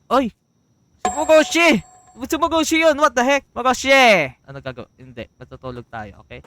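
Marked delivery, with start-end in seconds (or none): 0.1-4.3 high, loud astonished yells; excited shouting. Real, not acted. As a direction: shout at a startling sight. Spots creature in house.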